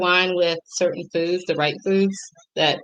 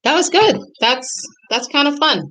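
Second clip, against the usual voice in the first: delighted; 8 semitones higher than usual. Real, not acted. Tasting wine pairing, delighted.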